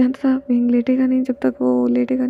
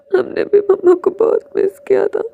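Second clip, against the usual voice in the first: put-on children crying; 6 semitones higher, 8 dB louder than usual. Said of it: "दोनों के आंसू साफ करता है".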